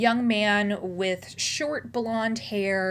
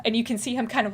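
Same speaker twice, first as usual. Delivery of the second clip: usual speaking voice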